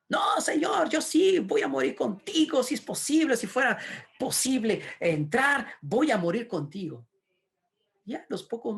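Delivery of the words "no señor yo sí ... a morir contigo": high, loud vow easing toward the end; impassioned protest. Acted. Peter swearing to die with his Lord, impassioned protest. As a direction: insist with fervent, shouted devotion.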